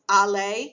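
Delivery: Aller, loud and rising, as a heading; French word, announced. Shown as a demonstration. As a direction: hold up Aller with a rising tune. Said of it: "the stem is ir".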